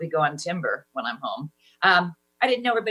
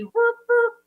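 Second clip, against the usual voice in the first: high hooting whoop; mock whoop; 5 dB louder than usual. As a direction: hoot like an excited old captain. Horny captain noise.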